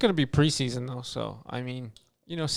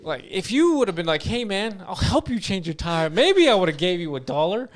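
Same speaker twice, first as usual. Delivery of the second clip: retold indignant protest; 5 semitones higher, 3 dB louder than usual. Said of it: "And I'm like".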